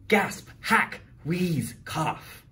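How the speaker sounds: coughing bus. Acted.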